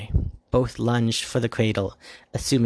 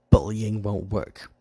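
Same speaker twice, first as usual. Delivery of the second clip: mildly spiteful. Acted. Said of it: "in a spiteful ma".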